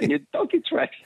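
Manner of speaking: high giggling aside; laughing high. Real, not acted. Caller laughing to the host about donkey tracks. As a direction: laugh it off, amused.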